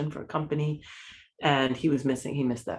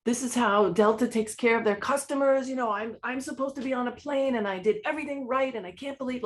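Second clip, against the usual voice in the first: much higher, exasperated ranting at the airline desk; angry outburst; 8 semitones higher than usual. Retold or imitated. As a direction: rant like an irate stranded traveler. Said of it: "kind of really flipping out".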